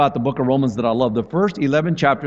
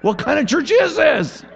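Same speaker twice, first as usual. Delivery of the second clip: high panicked near-shout; a nervous man losing it at a foot washing; freaking out; 10 semitones higher, slower than usual. Retold or imitated. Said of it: "he's freaking out".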